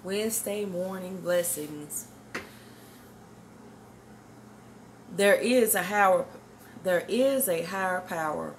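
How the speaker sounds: slow, measured devotional recital; preaching. Acted. Woman opening her morning video with a devotional recital. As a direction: intone each line like a sermon refrain.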